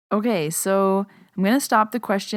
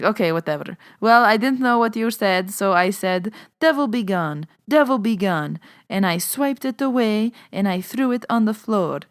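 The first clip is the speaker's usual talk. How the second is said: put-on higher-pitched, emphatic Lebanese old lady; grandma's Lebanese accent; 4 semitones higher, 5 dB louder than usual. Grandma proudly telling how she banished the devil, Lebanese accent. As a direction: imitate grandma's Lebanese accent with comic conviction. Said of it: "it's hilarious the way she says it".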